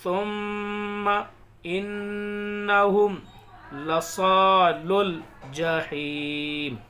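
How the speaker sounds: slow, loud, drawn-out tajweed recitation; measured recitation of verses; Quran recitation. Shown as a demonstration. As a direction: chant each word slowly with long pauses. Recitation continues.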